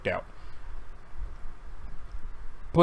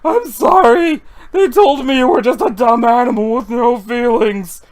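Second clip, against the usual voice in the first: high, loud pleading; desperate shout; 4 semitones higher, 9 dB louder than usual. Acted. Sable shouts a desperate apology to the duck. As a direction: plead loudly with the ghost duck.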